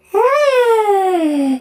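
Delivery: long, high falling wail; drawn-out cartoon cry. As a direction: let a high cry slide slowly downward. Cartoon figure cries out in chase.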